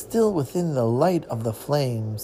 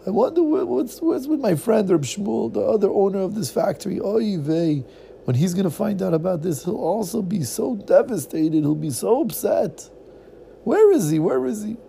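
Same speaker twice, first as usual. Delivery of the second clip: worried Yiddish man; 6 semitones higher than usual. Acted. Worried about his friend.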